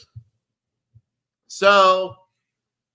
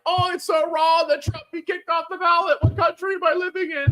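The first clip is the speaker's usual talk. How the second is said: high-pitched mock lament; mock dismay; 9 semitones higher than usual. Mimicking people upset about the ballot ruling, high mock dismay. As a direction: parrot the objectors in a high, whiny voice.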